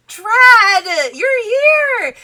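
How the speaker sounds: loud, high delighted cry; overjoyed greeting. Acted. Cinderella greets Trad after the kiss, loud high delight. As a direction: squeal it out in delight.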